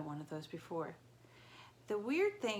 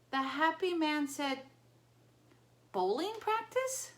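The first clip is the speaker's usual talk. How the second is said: high innocent Tyson voice; 9 semitones higher, 6 dB louder, slower than usual. Acted. Sleepy Tyson mishearing.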